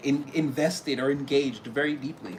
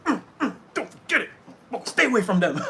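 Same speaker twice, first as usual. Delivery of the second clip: spirit team warning; 6 semitones higher, 4 dB louder than usual. Pretend.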